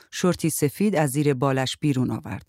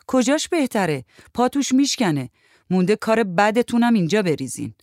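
raised-pitch grumbling complaint; nagging; 6 semitones higher, 3 dB louder than usual. A narrator's reading. Houri objects to staying in the room, nagging. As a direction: nag at them in exasperation. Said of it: "هوری نق زد".

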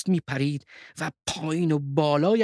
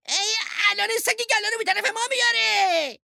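very high panicked yell; frightened young wolf's shout. A narrator's reading. Young wolf fears the sheepdog coming, shrieked warning. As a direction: scream a warning at top pitch. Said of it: "فریاد زد".

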